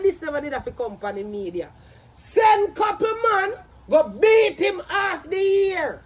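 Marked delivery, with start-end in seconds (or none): 2.2-6.0 slow, emphatic shouting; raised, drawn-out angry command; angry shouted order. Real, not acted. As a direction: shout each phrase out slowly in anger. Angry rant ordering a beating.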